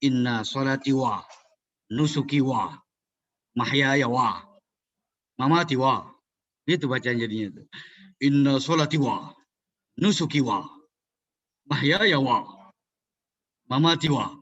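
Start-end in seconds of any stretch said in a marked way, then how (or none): 0.1-6.1 each "wa" tacked onto the end with a pause after it; wrong phrasing demo. Shown as a demonstration. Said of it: "Kalau di belakang ntar jadinya". 8.2-14.4 each "wa" tacked onto the end with a pause after it; wrong phrasing demo. Shown as a demonstration. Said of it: "Kayak orang Jepang jadinya tuh".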